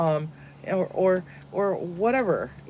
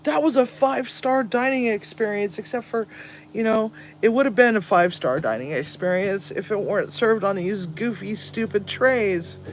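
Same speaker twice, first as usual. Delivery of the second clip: higher, mock-grand food review; inmate's joking complaint; 7 semitones higher, 3 dB louder than usual. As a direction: play the cheeky inmate critic. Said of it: "This guy's like".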